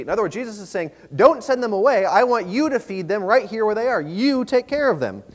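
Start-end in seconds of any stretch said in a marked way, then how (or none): 1.1-5.2 louder, higher, insistent instruction; forceful directive with punched "You"; emphatic command. Acted. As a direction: put the job firmly on the listeners. Voicing Jesus's emphatic command.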